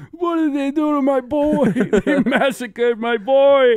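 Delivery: high-pitched, drawn-out mock anguish; Godfather impression. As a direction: wail it like a hammed-up grieving mob father. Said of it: "like in The Godfather".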